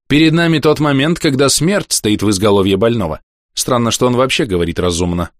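a hint of a blunt stern physician; stern doctor voice. Доктор поучает старика. (лёгкий голос хмурого доктора)

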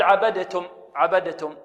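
عبدتم as "abade-tum"; wrong bounced d. Shown as a demonstration. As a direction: release the د with a small vowel before تم in عبدتم. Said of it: "هل هذا صحيح".

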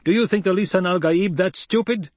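high, loud angry rebuke. Acted. Paul scolding the doubting crowd in anger. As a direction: hurl it at them in anger. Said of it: "hurled his voice at them in anger".

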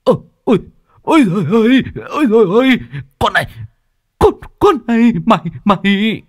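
high wail of pain with stammered curses; pained howling. A narrator's reading. Thug struck on the forehead by the pot, pained howling. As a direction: howl in pain, stammer at her. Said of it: "Nó rú lên".

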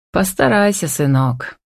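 plain reading voice

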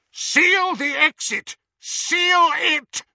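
loud high barked command; shouting orders. A narrator's reading. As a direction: bellow the order.

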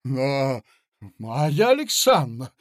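loud exasperated cry; outraged cry. Acted. Mozglyakov crying out at Marya, exasperated. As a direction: cry her name in outrage. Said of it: "вскричал он".

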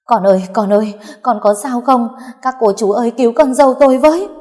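loud, high, theatrical fake crying plea; fake wailing. A narrator's reading. Mother-in-law loudly fake-wailing for help to the nurses. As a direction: ham up the tears, crying out for help. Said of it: "giả nhân giả nghĩa khóc lóc rồi nói".

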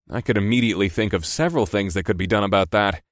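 ordinary delivery

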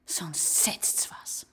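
very slow hushed menace; quiet menacing whisper; whispered threat. Acted. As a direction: hiss the warning low. Quiet threat after shouting.

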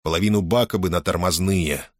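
ordinary delivery, nothing marked

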